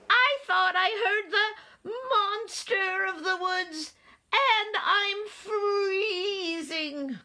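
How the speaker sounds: tiny high-pitched wailing mouse; calling mouse. A narrator's reading. Mouse freezing outside, pleading with Owl, high wail. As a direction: wail up high, drawing out the last word. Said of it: "called Mouse".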